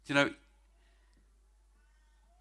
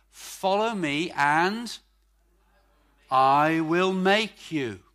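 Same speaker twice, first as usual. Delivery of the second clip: slow emphatic preaching; 2 semitones higher, 7 dB louder, much slower than usual. Acted. Quoting Jesus while preaching.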